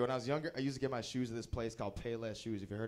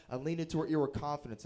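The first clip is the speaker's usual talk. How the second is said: ordinary talk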